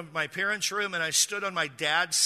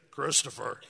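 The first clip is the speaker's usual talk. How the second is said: a hint of a deep radio voice, lightly lowered; understated deep fatherly call; deep dad voice; 4 semitones lower than usual. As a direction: lightly mimic dad's low radio voice saying the name. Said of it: "my dad's got a real deep voice".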